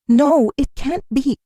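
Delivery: high-pitched, shocked protest; gasped. A narrator's reading. Seifu reels at the figure's words, gasped disbelief. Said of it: "he gasps".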